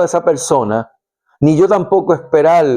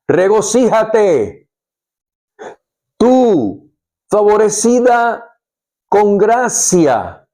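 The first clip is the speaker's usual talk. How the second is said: higher, slow, ringing proclamation; joyful exclamation; 6 semitones higher, slower than usual. Acted. Angel Gabriel bursting in on Mary, exclaiming slowly and higher. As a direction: announce it with joy, drawn out. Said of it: "Gabriel le exclamó".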